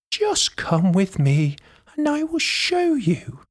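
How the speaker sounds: high, admiring granny voice; delighted old lady. A narrator's reading. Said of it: "the old lady said".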